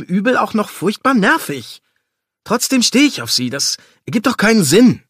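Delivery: loud, high-pitched venting; exasperated rant. Acted. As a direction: let the frustration burst out at full volume. Venting about the exasperating woman.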